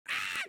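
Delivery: sharp squeal of exasperation; high frustrated shriek. Real, not acted. Streamer losing it at the clip with a high yelp. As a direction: let out a high-pitched yelp. Peak of the rant.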